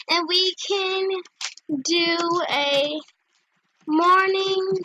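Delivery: halting, long gaps between words; typing aloud. Real, not acted. Typing a morning routine idea to friend, slowly. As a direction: spell out the message word by word.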